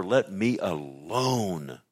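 drawn-out, measured plea; slow plea. Acted. Preacher as Job talking to God, slow drawn-out plea. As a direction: stretch each word, lingering on the last.

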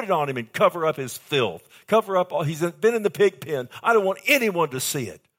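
loud, high preaching cry; impassioned father's command. Acted. Father telling the servants to cover his boy, shouted. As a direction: call out urgently to cover the son's shame.

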